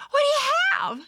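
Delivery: put-on high, insistent little-kid voice; pestering little sister. A narrator's reading. Little sisters nagging Thumper for a share, high and eager.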